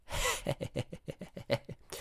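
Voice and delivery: soft, pleased laugh; quiet chuckle. Real, not acted. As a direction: chuckle softly at your own joke. Laughs at own word fix.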